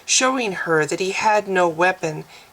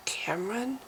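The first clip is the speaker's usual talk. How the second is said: whispered question, rising; a woman whispering a name in disbelief; 4 semitones higher, 13 dB quieter than usual. Acted. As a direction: breathe his name in a whisper. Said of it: "she whispered".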